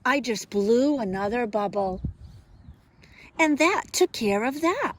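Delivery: lively, expressive picture-book reading; reading to children. Acted. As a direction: read it like storytime for little kids.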